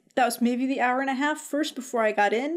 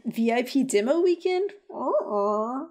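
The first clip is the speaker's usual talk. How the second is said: doubtful dismissal; 3 semitones higher, slower than usual. Shown as a demonstration. Co-host rejecting hype for the demo, doubtful. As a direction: question it sceptically, then turn it down.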